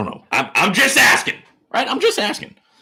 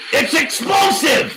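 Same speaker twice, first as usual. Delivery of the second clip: high, slow, drawn-out shout; stretched high hype shout; hype yell; 13 semitones higher, slower than usual. Real, not acted. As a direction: blow it up like an announcer. Hype exclamation on the stream.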